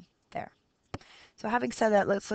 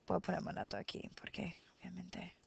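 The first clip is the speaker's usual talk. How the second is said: a quick unvoiced aside under the breath; whispered aside; 15 dB quieter, faster than usual. Real, not acted. Teacher to class, adding a whispered aside. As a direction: drop to a whisper for the obvious bit.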